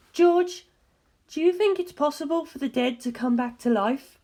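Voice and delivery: a hint of a grave woman asking; earnest, probing question, lightly voiced; a faint woman's voice, asking seriously; lightly voiced Darlington. A narrator's reading.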